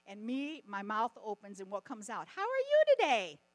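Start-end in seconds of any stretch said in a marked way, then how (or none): 2.3-3.4 high, loud sing-song how are you today; loud bright greeting. Shown as a demonstration. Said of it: "I don't know why I do that".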